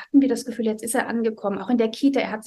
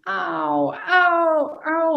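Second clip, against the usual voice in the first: put-on high, long-drawn child's "Au" cries; son's wail as he starts screaming again, imitated high; drawn-out pain cries; 6 semitones higher, much slower than usual. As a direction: stretch each "Au" like a child wailing in pain. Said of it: "ganz lang gezogen".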